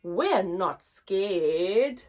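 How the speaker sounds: usual speaking voice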